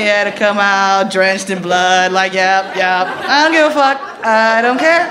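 normal talking voice

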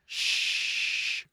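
loud drawn-out shh; long shush. Acted. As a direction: shush the room at length. Acting out the clue "quiet.

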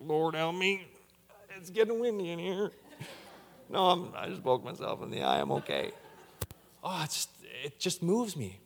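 soft, tearful, half laughing; softly fighting tears while joking; choked up. Real, not acted. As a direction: hold back tears and joke through them. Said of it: "I well up".

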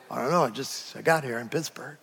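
low, soft mumbled excuse; sheepish excuse. Acted. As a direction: own up quietly to the mistake. Acts out driver who went astray.

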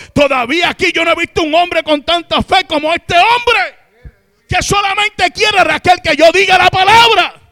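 high, loud, slowing preaching cry; a preacher thundering to the congregation; fervent preaching shout. Acted. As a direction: preach it at full force. Sermon at full preaching force.

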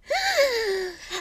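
gasping little shriek; a sharp startled gasp; soft scream. A narrator's reading. Seer seeing thirteen at table, soft scream. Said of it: "a kind of soft scream".

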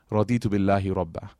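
fast, low recited supplication; Arabic recitation. Acted. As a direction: recite the dua swiftly.

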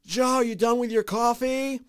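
high, drawn-out call imitating wife. Wife yelling to husband from a different floor, mock shouted call. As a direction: holler it high as if to another floor. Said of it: "she will ask me from a different floor".

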